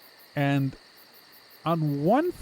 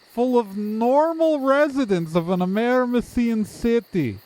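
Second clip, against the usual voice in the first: higher-pitched, stilted friendly townsperson; overly normal townsperson; 5 semitones higher than usual. Acted. Game master as townsperson greeting the newcomers, stiltedly cheerful. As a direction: greet like a creature faking being normal.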